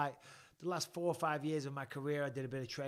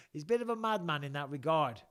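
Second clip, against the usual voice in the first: usual speaking voice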